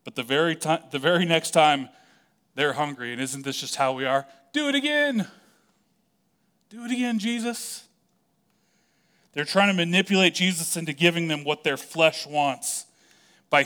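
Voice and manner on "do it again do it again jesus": high, put-on begging voice; whiny crowd. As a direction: mimic a needy crowd pleading for more. Crowd begging Jesus for more.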